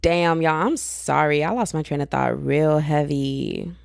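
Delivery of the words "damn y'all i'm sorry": ordinary talk, nothing marked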